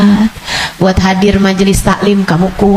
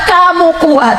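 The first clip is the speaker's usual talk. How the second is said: normal talking voice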